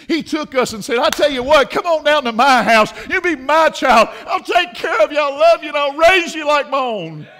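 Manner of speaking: loud, high pulpit delivery; full-force preaching. Real, not acted. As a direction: proclaim the adoption offer with force.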